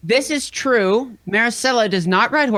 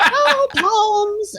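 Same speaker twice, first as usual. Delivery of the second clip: mocking; 11 semitones higher than usual. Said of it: "She always says".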